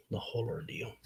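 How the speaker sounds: soft unvoiced echo of the phrase; whispered aside. Real, not acted. Echoing his own words to the stream, whispered. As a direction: breathe the words out as a private aside.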